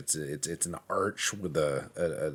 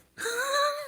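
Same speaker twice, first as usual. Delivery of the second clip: high laugh. Real, not acted. Laughs at own joke.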